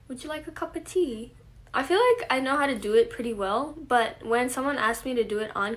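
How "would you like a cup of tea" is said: lightly put-on British accent, higher pitch. Shown as a demonstration. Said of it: "Can you do a British accent?".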